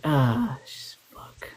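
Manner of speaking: normal talking voice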